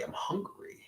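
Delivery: whispered to himself; hungry whisper, no voice at all. Real, not acted. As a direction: breathe it out with no voice.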